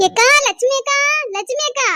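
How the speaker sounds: high, loud call from a distance. Acted. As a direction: shout for Lakshmi akka across the yard. Calling the neighbour from outside. (தூரத்திலிருந்து உரத்த அழைப்பு)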